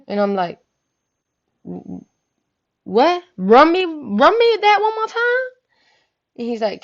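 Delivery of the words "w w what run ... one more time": stammer, then a high loud demand to repeat; acted-out disbelief, pitch shooting up; incredulous. Cousin asked to allow a hug, incredulous.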